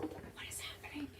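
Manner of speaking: whispered what is happening. Retold or imitated. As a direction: hush it out, unsettled. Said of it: "I was like".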